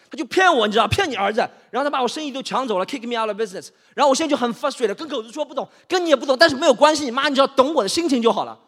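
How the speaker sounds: high, loud venting; worked-up, exasperated tirade; frustrated. Acted. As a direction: rant at mom in frustration.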